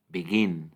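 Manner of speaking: "Begin" with a light BE and a strong, falling GIN; teacher's demo of "Begin", second syllable punched; stress on second syllable. Shown as a demonstration. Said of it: "Y en begin es la segunda".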